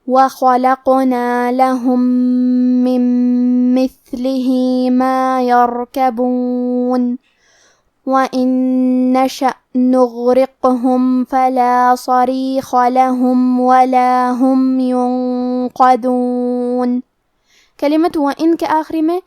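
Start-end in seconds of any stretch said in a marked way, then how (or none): 0.1-7.1 slow melodic tajweed chant; measured Quranic recitation. Shown as a demonstration. 8.1-17.0 slow melodic tajweed chant; measured Quranic recitation. Shown as a demonstration.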